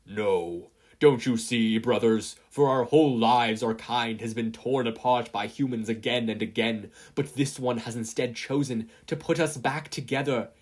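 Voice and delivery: loud, booming machine voice; booming robotic voice. A narrator's reading. Zero-pointer addresses the other robots, booming robotic voice. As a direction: boom it out like a giant robot. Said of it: "its booming robotic voice".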